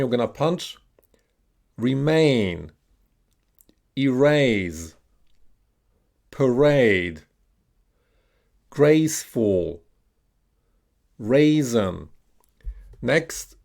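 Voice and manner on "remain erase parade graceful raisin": "raisin" with a drawn-out AY, falling tune; model pronunciation. Shown as a demonstration. Teacher modelling long A words for learners to repeat, careful and slow. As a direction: say each word slowly and separately, long AY in "remain". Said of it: "repeat after me".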